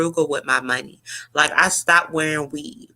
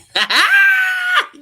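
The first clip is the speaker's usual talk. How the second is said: a high, loud burst of real laughter; helpless shriek of laughter; 6 dB louder than usual. Real, not acted. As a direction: lose it completely at the photo. Cracks up at the picture.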